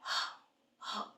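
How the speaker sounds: ह as a soft puff of breath "h" with no vowel; just breathe out ह, no "a" after it; breathy h sound. Shown as a demonstration. Teacher shows students the phonics sound, ह as a breath. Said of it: "हम इसको pronounce करेंगे".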